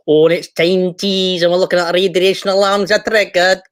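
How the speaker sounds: badly faked Scottish accent, pushed hard; awful Scottish accent. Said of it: "Could, could I do Scottish?".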